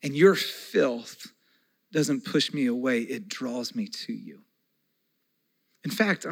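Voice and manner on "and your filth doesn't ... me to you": slow, fading to a near hush; tender Jesus. Acted. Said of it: "And Jesus says".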